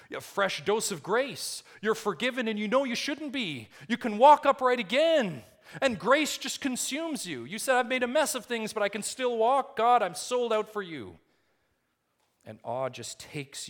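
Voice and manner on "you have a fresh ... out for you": high, loud and swept away with grace; fervent rising cry. Acted. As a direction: preach it like a soul on fire with awe. Preacher acting out the believer's zeal.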